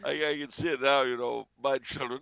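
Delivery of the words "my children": ordinary delivery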